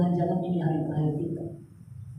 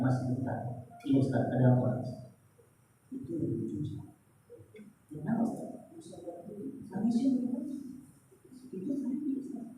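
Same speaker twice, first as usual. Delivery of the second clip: hushed exchange; 11 dB quieter than usual. Retold or imitated. Hushed talk with prison guard.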